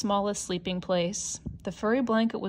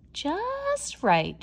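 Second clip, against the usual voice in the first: put-on high, pleased girl's voice; satisfied little girl; 11 semitones higher than usual. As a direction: sound delighted it finally fits. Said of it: "she said".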